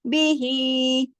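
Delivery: बी ही, very slow, ही held; slow recitation. Shown as a demonstration. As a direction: recite बी ही drawn out. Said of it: "पूरा बा को नहीं खींचा". (बी ही धीमी तिलावत)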